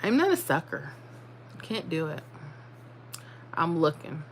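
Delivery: usual speaking voice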